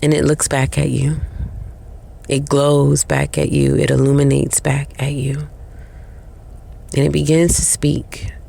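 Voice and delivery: ordinary talk, nothing marked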